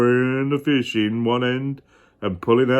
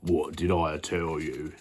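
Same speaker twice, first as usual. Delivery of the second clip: miller voice; 8 semitones lower, 10 dB quieter than usual. Acted. Miller boasting to the king.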